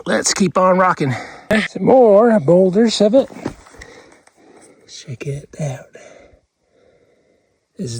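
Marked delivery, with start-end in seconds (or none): 4.9-8.0 whispering. Real, not acted. Hushed showing of rock.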